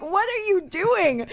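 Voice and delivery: high, stretched-out mock panic; freaking out. Woman retelling her reply to the parking attendant, freaking out. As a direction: re-enact alarm with pitch way up. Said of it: "like, freaking out".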